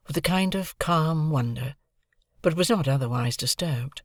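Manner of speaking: usual speaking voice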